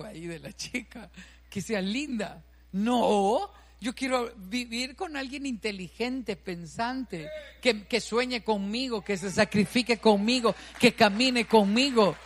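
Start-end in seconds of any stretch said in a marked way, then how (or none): 2.7-12.2 grows louder line by line up to a full-voice cry; building to a shout. Real, not acted. Preacher to congregation, swelling to a loud climax.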